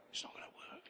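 breathy whispered echo of the line; a whispered afterthought to the congregation. Shown as a demonstration. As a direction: drop to a bare whisper, hushed.

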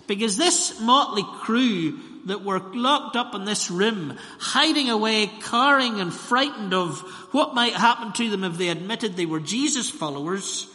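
preaching. Acted. Preacher to congregation, loud and rising preaching. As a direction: drive the story home from the pulpit.

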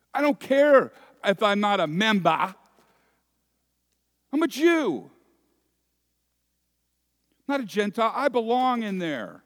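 loud, high, furious insistence; shut-out man raging at the door, loud and high; enraged protest. Acted. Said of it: "tears of rage".

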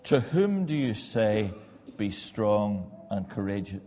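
slow searching question. Acted.

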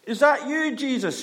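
high, loud call across the water; Peter shouting. Retold or imitated. Peter in the boat shouts to Jesus, high and loud. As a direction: call out to the figure on the waves. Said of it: "shouts Peter".

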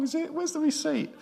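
high, joking panic; mock dismay. Dad joking to the congregation, high mock dismay. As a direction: pretend to want a refund, high. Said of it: "I thought".

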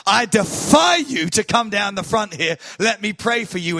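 high, loud preacher's defiance; shouted challenge. Retold or imitated. Preacher retelling shouting back at the drunk soldier. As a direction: throw the dare back at him. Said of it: "I shouted back at him".

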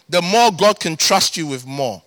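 usual speaking voice

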